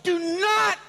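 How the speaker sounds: slow, high, loud command; scolding yell. Retold or imitated. Wife to racing sons, slow stern yell. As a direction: holler it word by word. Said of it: "meine Frau schreit ihnen her".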